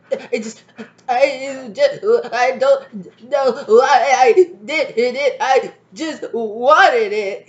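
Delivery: put-on high, slow blubbering child voice; mock crying child. Imitating a crying little kid explaining themselves, high slow blubber. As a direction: sob it out like a kid caught misbehaving. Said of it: "you'd be like".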